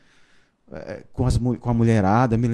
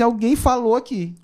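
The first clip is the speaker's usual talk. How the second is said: startled, higher exclamation; 8 semitones higher than usual. Retold or imitated. Startled passenger on the bus, hearing a voice: higher, surprised. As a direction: blurt it out as if someone spoke beside you. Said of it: "que eu peguei um susto".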